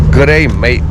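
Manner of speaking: loud Australian G'day mate; Aussie accent. Shown as a demonstration. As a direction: run Good day mate together. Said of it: "That's how you say to somebody".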